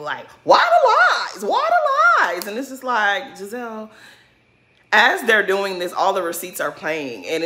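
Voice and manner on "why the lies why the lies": much higher, squeaky mocking whine; mocking a childish whine. Mocking Giselle to viewers in a squeaky childish whine. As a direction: parrot her like a bratty twelve-year-old. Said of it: "like a twelve-year-old".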